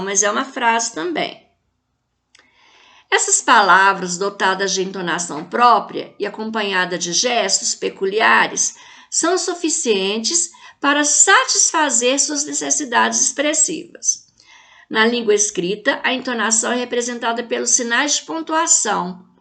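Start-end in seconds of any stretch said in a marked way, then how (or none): none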